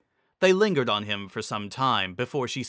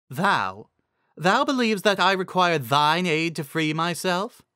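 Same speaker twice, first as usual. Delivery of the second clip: higher, indignant disbelief; affronted queen; 6 semitones higher than usual. Acted. Celestia offended by Zecora, higher and indignant. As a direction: stammer then rise in outrage.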